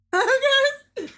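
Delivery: high, loud squeal of a name; alarmed cry. Real, not acted. Scared player calling out to a friend, high alarmed squeal.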